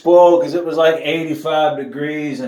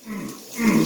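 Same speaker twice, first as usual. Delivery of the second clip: usual speaking voice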